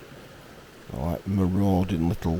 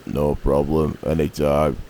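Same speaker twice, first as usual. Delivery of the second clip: low, offhand badger voice; lightly voiced laid-back badger; gruff badger; 4 semitones lower, 4 dB louder than usual. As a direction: shrug it off, lower. Badger answers.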